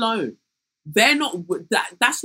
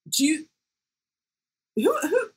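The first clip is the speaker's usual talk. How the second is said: usual speaking voice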